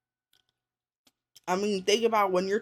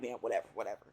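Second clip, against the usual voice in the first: dismissive mutter; 9 semitones lower, 9 dB quieter, much faster than usual. Retold or imitated. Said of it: "I'd be like".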